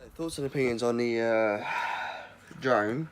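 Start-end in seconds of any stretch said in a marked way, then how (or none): none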